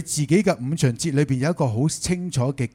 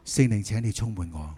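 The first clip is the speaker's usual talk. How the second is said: soft, lower-pitched prayer; model prayer; 6 semitones lower, 7 dB quieter than usual. Shown as a demonstration. Preacher showing how to talk to God, soft model prayer. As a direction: pray it softly and simply. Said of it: "你可以同神講".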